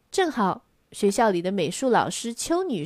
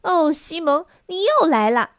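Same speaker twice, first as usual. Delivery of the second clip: higher and louder, fed up; exasperated cry; 7 semitones higher, 4 dB louder than usual. A narrator's reading. Big sister crying out at Simon again, exasperated. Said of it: "阿黛拉叫起来".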